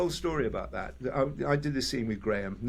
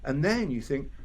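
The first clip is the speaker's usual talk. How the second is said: ordinary talk, nothing marked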